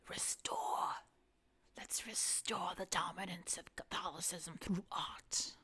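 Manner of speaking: hushed, whispery put-on accent with dropped R's. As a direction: whisper it in a playful non-rhotic accent. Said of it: "I said that in an accent".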